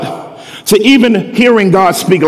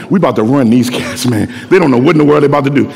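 lower, fast, cocky boast; cocky low trash talk; 6 semitones lower, faster than usual. Retold or imitated. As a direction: brag to your teammates. God's team boasting.

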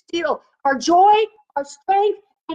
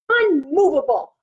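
usual speaking voice

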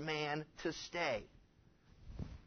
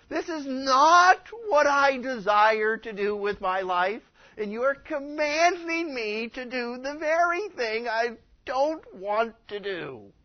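acted indignant cry, raised and drawn out; exasperated protest; 4 semitones higher, 6 dB louder than usual. Believer questioning God's command over their life, exasperated protest. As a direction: protest loudly, leaning on each word.

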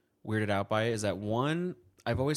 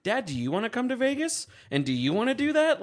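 higher, laughing mock exasperation; exasperated retort; 12 semitones higher, 4 dB louder than usual. Son teasing his dad about the Vegas advice, mock exasperated. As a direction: throw it back at him, half laughing.

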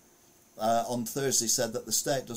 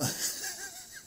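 a squealing burst of laughter; high squeal of laughter; 6 dB quieter than usual. Real, not acted. Vlogger laughs at the vandals photo, high squeal of laughter.

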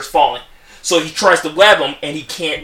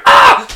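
short loud scream; partner's loud startled scream; loud scream from the partner off screen; 12 dB louder than usual. Retold or imitated. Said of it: "you hear his partner scream".

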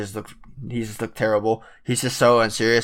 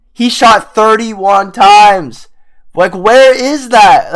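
exasperated; 8 semitones higher, 9 dB louder, much slower than usual. Real, not acted. Ranting about his shot count.

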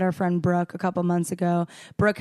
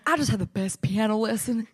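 lightly imitated Texan accent, excited. Host retelling her excited story post in a light Texan accent. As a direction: gush about the lesson in a mild Texan twang. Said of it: "going off in this Texan accent".

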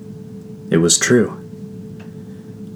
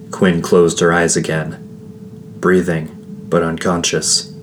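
usual speaking voice

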